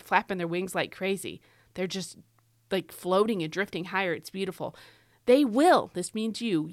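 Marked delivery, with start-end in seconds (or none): 5.3-5.9 "will" punched high and loud; loud, emphatic "will"; stress on WILL. Shown as a demonstration. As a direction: hit "will" hard, as a promise. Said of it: "all the times in this verse where God says will".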